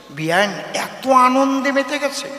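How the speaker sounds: ordinary delivery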